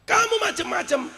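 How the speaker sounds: preacher voicing a high, stern threat; imagined divine threat. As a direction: warn them off on a high note. God's warning, acted by preacher.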